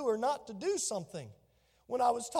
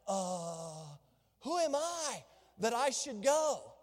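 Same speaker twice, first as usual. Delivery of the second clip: hesitant Moses; slower than usual. Acted. Moses doubting God's call.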